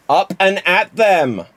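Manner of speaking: lightly voiced rally call; Simpsons catchphrase. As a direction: cheer the barman on like a cartoon boss. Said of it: "do it as Jimmy Mac".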